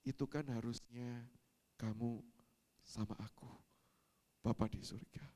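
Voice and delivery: low, soft, halting voice; God speaking gently. Retold or imitated. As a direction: speak quietly with long pauses. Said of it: "seolah-olah Tuhan berkata".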